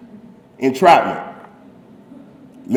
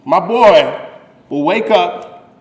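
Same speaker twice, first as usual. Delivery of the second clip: ordinary delivery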